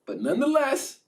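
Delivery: high, strained nonetheless; Jesus yielding to God; resigned prayer. Retold or imitated. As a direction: lift it up then let go.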